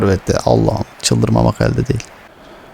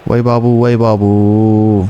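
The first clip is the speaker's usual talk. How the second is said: slow, drawn-out amazement; amazed; slower than usual. Real, not acted. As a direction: stretch it out in awe. Amazed at a play.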